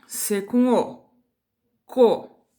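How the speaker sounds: "co" forte e destacado, devagar; sílaba marcada para as crianças repetirem; sílaba em voz alta. Shown as a demonstration. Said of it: "a gente vai falar as sílabas em voz alta". (professora diz "co" bem alto)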